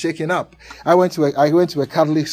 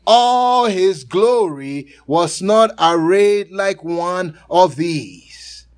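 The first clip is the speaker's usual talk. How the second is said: slow, louder solemn reading of scripture; 2 semitones higher, 5 dB louder, slower than usual. Acted. Preacher reading Matthew six to the congregation, slow solemn reading. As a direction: lay each phrase down slowly.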